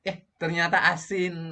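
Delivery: a cook hamming up surprise to keep viewers relaxed; playful surprise. Shown as a demonstration. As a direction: exaggerate the shock, playfully. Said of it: "biar tidak tegang".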